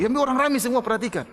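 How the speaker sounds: ordinary talk, nothing marked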